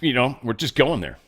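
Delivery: usual speaking voice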